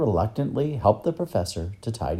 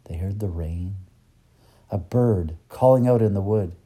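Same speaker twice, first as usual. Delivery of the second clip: ordinary delivery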